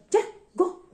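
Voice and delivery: high, loud angry order; angry command. Retold or imitated. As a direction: shoo her away with a bark. Asis telling her mother to go. (galit na utos, malakas at mataas)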